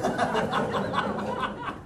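high breathless laugh mid-story; airy laugh. Real, not acted. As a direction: break into a light laugh. Amused memory of seminary.

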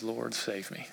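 a listener at the end of their rope, pleading softly; whispered-soft plea. Retold or imitated. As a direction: pray it like you've nothing left. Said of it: "the only thing you can say is".

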